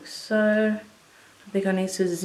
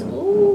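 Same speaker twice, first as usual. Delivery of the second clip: very high squeal of delight; thrilled little ooh; 13 semitones higher than usual. Real, not acted. Seeing the highlighter on her cheek, a high delighted ooh.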